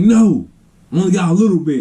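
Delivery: high-voiced imitation of an aunt; refusing aunt. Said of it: "She's like".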